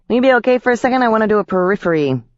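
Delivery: high pixie voice, quick on the end; a lightly voiced busy pixie. A narrator's reading. Pixie asks, then zips off.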